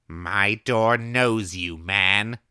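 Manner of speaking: slow, loud firm proclamation; declaring. A narrator's reading. Old woman declaring through the door to the drunk man. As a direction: declare it like a warning. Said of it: "Missus Miller declared from within".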